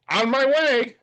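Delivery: high, breezy mock announcement; chirpy message voice. Acting out Nick's cheery message.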